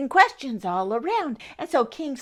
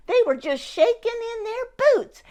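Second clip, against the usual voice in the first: high, trembling mock fear; a wobbly, hammed-up quiver of fear; 10 semitones higher than usual. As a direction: make the words shake like scared soldiers. Army afraid of the giant.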